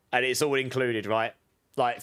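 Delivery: usual speaking voice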